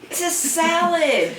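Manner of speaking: laughing. Real, not acted. Amused at a cold salad dinner.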